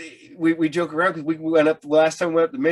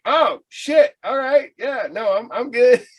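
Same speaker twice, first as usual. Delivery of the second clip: higher, flustered stammering refusal; mock nervous panic; 7 semitones higher, slower than usual. Tourist refusing the van dealers near a cop, flustered. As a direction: back out fast, rattled and laughing.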